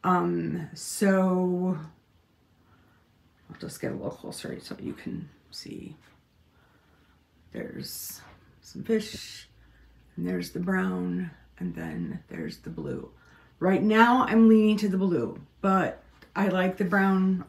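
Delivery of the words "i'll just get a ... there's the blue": much softer, near whisper; hushed close-up. Real, not acted. Showing viewers the fabric up close, hushed. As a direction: hush as you lean in to the fabric.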